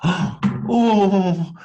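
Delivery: a small wooden puppet calling out, pitched high and worried; Punchinello crying out. Acted. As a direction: call after her high and anxious. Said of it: "Punchinello cried out".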